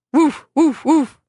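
imitating a dog's woof. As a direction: woof like a dog on guard. Dog swipes at rooster.

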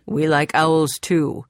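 slow, relaxed answer to the password; relieved reply. A narrator's reading. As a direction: ease into we like owls too.

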